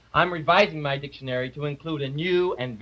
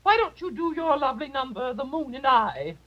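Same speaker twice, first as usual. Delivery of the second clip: high falsetto society lady; high lady's voice calling for the song; fluttery hostess; 10 semitones higher than usual. Acted. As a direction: gush like a grand hostess, up high.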